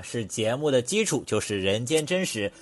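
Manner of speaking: normal talking voice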